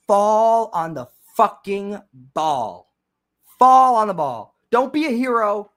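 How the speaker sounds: high, drawn-out exasperated plea; slow, pleading fan frustration. Real, not acted. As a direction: stretch out the words in sheer frustration. Exasperated plea at team's fumble play.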